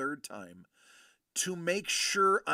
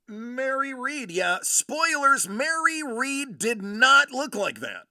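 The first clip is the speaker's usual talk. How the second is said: excited outburst; 8 semitones higher, 8 dB louder than usual. Real, not acted. Excited outburst at relic portrait.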